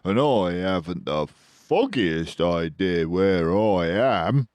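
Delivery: a weary lost digger pleading; anxious Badger voice. A narrator's reading. Badger voice in read story.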